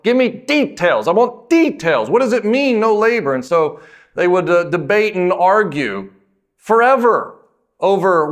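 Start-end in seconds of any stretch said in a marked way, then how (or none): 0.0-3.3 imitating insistent demands for details, pitch well higher; mock demanding rabbis. Rabbis demanding details of Sabbath law. 6.6-7.4 forever pushed high and loud, stretched out; stressed forever. Real, not acted. Emphasis on endless debate.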